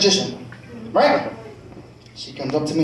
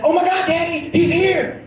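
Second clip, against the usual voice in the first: put-on high, thrilled child's cry; excited girl; 10 semitones higher than usual. Daughter excited at the school door, high thrilled cry. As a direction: squeal it like a kid spotting Santa. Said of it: "She said".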